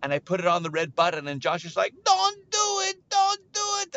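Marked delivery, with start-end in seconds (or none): 2.0-4.0 high, loud mock panic; frantic pleading. Josh begs his friend not to press it, panicked. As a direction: plead urgently in a squeal.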